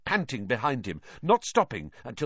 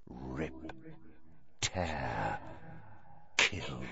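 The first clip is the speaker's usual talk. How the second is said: much lower, much softer menacing rasp; cold murderous voice; 9 semitones lower, 12 dB quieter, much slower than usual. Acted. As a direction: breathe each word like a monster. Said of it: "the same cold, murderous voice".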